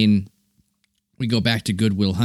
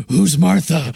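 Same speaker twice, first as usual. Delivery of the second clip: higher, hammed-up dramatic hero question; Ben Affleck impression; 5 semitones higher, 4 dB louder, slower than usual. Mocking a film star's hero role, overdramatic questioning. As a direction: demand it like an overwrought movie hero. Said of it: "And then we have Ben Affleck".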